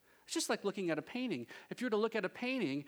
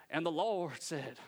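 slow, drawn-out Southern preacher drawl; mock Southern drawl; 5 semitones lower than usual. TV preacher from Southern California faking it, mock Southern drawl. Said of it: "they have a Southern drawl".

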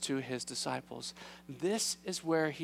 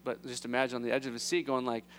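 ordinary talk, nothing marked

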